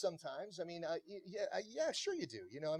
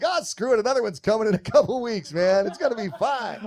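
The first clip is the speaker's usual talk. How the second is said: carefree; 4 semitones higher, 8 dB louder than usual. Retold or imitated. Retold carefree teen self.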